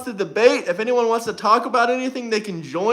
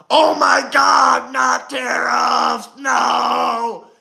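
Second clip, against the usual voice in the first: drawn-out mocking wail of despair; mock scream; much slower than usual. Mocking the Democrats with a wailing scream. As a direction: ham up a panicked scream, stretched slow. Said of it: "the Democrats will start screaming at the top of their lungs".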